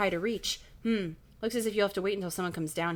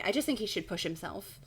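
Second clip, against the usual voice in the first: ordinary delivery, nothing marked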